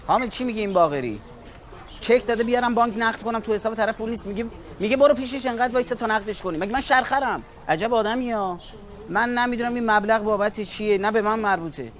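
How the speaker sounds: high, agitated rant about the bounced check; exasperated complaint. Acted. A clerk complains to his boss about a bounced check, high and indignant. Angry phone complaint to Hamed.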